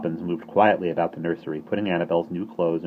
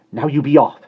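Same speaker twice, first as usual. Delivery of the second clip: loud, higher angry order; 8 semitones higher, 11 dB louder than usual. Acted. Nanny angrily shooing the birds away, loud and sharp. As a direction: shoo them off. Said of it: "she said angrily".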